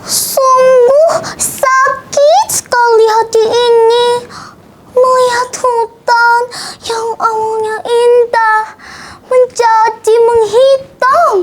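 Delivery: high, slow, tearful character voice; anguished Ciko. A narrator's reading. Ciko looking over the burnt forest, high and grieving. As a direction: lament in a high, drawn-out wail. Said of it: "gumam Ciko sembari melihat kondisi hutan".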